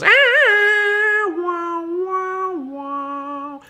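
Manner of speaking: high wailing "ah", trailing into wah-wahs; imitated film theme howl. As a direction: mimic a western theme's coyote cry, loud then fading. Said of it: "And then he goes".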